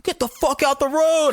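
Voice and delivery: high-pitched hammed-up shout at a driver; mock yell. Passing drivers yell at him after his wreck, a high mock shout. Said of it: "People were like".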